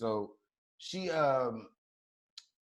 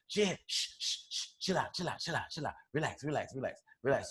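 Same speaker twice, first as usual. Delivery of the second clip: hushed, softer soothing repeats; soft shushing; 2 semitones lower, 10 dB quieter than usual. Retold or imitated. Girlfriend pulling him out of the fight to calm him, hushed. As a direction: shush him down like calming a child.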